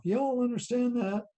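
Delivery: playful high-pitched Southern question; Southern accent. Pretend. Teacher checking the class follows, playful Southern accent. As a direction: ask it with a light Southern twang. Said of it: "little Southern talk".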